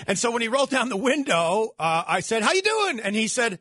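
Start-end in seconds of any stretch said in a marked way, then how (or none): 2.4-3.0 high, chummy greeting; mock friendly greeting. Host greeting lookalike coach, high and chummy. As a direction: greet him like an old pal. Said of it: "like that, like he recognized me".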